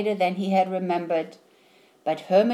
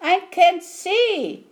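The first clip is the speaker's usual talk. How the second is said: much higher, slow, worried snail; small snail voice; 11 semitones higher, 4 dB louder, slower than usual. A narrator's reading. Snail in dark seaweed, higher, slow and worried. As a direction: stretch it out like a lost little snail. Snail character speaks in story.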